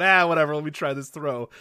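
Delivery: high, breezy mock shrug; careless quarterback. Imitating Brett Favre slinging a careless throw, breezy. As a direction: toss it off like nothing matters. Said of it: "just going".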